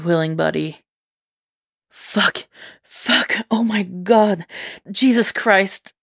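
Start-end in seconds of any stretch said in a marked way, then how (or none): 1.9-5.9 breathy panting curses rising higher. Acted. Eddie gasps out curses to Venom, panting and rising in pitch. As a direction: gasp the words out between breaths. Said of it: "Eddie panted".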